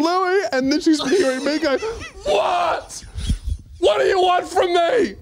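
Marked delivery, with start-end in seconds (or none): 0.0-0.4 high-pitched name call; woman calling out. Retold or imitated. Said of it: "she's going". 2.2-5.1 very high-pitched, strained cries; hammed-up outburst at a voice in your head; mock hysterical yelling. Mimics himself yelling as overheard.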